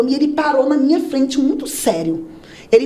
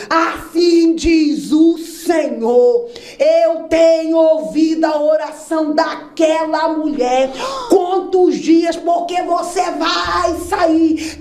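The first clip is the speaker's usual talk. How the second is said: prophetic proclamation; 5 semitones higher, much slower than usual. Retold or imitated.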